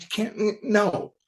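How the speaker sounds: high-pitched incredulous objection; protesting. Retold or imitated. Publisher rejects writer's already-used title, protesting.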